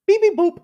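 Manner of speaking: chirpy "Beep, beep, boop"; beeping phone noise. Pretend. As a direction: imitate a phone's text alert. Imitating a text alert.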